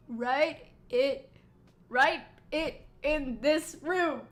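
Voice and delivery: loud, halting, emphatic put-on voice; improvised character voice. Game character announcing the event's location, improvised voice. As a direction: spell it out slowly and forcefully in a makeshift voice. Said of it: "It doesn't sound anything like he would".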